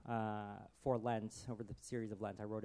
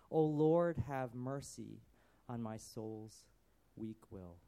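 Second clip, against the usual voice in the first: remorseful Peter soliloquy; 3 dB louder than usual. Acted. Preacher reading Peter's soliloquy aloud, remorseful lament. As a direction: pour out shame in verse. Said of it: "the second soliloquy I wrote was Peter's".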